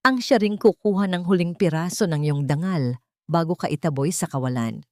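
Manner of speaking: ordinary delivery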